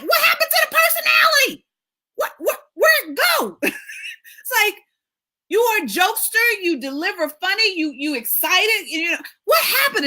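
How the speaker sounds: hammed-up shock at a shrinking client; mock disbelief. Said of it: "And I'm like".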